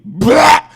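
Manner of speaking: loud retching blah; loud vomit imitation. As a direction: fake a puke out loud.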